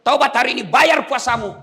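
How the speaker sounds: high, loud preaching; forceful exhortation. Real, not acted. Preacher urging prisoners to make up fasting, forceful exhortation. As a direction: drive the command home.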